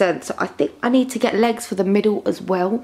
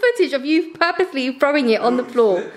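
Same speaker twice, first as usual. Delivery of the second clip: talking through laughter, much higher; laughing; 8 semitones higher than usual. Real, not acted. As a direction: giggle through the accusation. Laughing while accusing.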